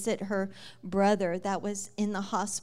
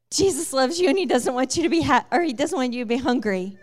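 higher and louder, tumbling out nervously; eager blurt; 4 semitones higher, 9 dB louder, faster than usual. Retold or imitated. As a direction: blurt it out to strangers, nervous and earnest. Blurting to homeless men.

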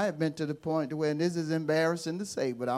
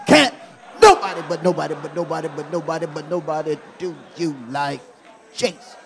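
fast, laughing repeat with more feeling; with more meaning; 2 semitones lower, 9 dB louder than usual. Shown as a demonstration. Preacher repeating the chant with more meaning. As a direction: say it again and mean it. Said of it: "say it with a little more meaning".

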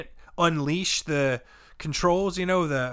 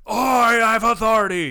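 put-on self-important voice, louder and higher; mocking authority; 4 semitones higher, 6 dB louder than usual. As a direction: mimic someone puffed up with power. Mocks the manager's authority.

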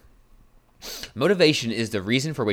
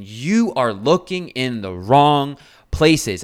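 slow, louder, each word driven home; hammering the point to listeners; slow emphatic point; 3 semitones higher, 6 dB louder, much slower than usual. Real, not acted. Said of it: "my thing that I really wanna drive across".

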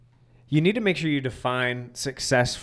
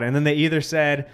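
normal talking voice

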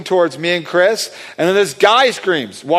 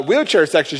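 ordinary delivery

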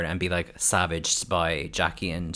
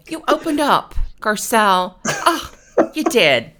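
very high, louder, patronising comfort; mock consoling; 14 semitones higher, 8 dB louder than usual. Mocking the castmates consoling Garcelle, high patronising coo. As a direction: coo it like a fake-sympathetic castmate.

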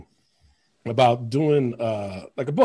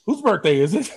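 raised, louder mock-loud asking; a loud friend asking at a party, retold with a mild raised voice; loud retold question; 7 semitones higher, 4 dB louder than usual. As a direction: boom out the question like loud John. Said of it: "he said it loud too".

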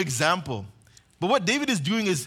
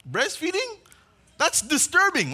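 mock disgust; 10 semitones higher than usual. Preacher mocks a grown man breastfeeding.

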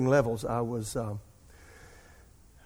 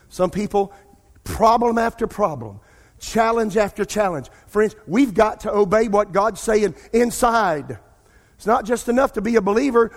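louder, higher, building exhortation; preaching at full force; 6 semitones higher, 7 dB louder than usual. Acted. Preacher pleads with congregation to obey, rising and loud. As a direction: drive the point home to the congregation.

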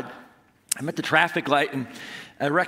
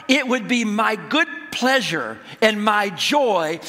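raised, emphatic sermon delivery; preaching; 5 semitones higher, 3 dB louder than usual. Real, not acted. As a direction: drive the point home to a congregation. Preaches after telling the story.

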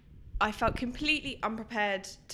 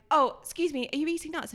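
raised voice; 7 semitones higher than usual. Retold or imitated. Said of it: "shouting at this woman".

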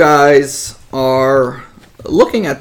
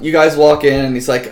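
ordinary talk

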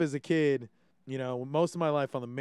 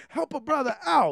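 mock plea; 12 semitones higher, 4 dB louder than usual. Mock plea to fellow locals.

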